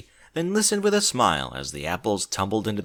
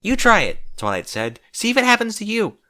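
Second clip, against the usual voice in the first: pushy Twilight; 11 semitones higher, 5 dB louder than usual. Acted. Twilight urges Rarity to try.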